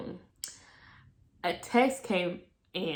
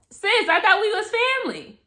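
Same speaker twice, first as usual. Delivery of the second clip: high, loud betrayed retort; hurt disbelief; 11 semitones higher, 4 dB louder than usual. Retold or imitated. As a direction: throw the betrayal back at her.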